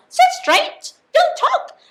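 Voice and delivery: high, loud sharp commands; a stern teacher ordering the class; shrill classroom order; strict teacher. Acted.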